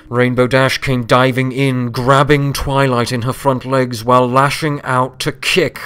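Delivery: urgent action narration; excited. A narrator's reading. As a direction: read it with rising urgency.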